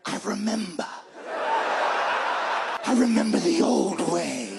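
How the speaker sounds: slow, drawn-out hungry cat voice; cat's ancestral memory. Acted. Comedian voicing his cat eyeing squirrels, slow and hungry. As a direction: let the wild instinct rise, slowly and loud. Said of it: "he's like".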